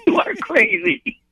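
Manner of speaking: slow, high, drawn-out astonishment; laughing disbelief. Retold or imitated. Friend hearing about pizzas to Iraq, laughing disbelief. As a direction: stretch it out high through laughter.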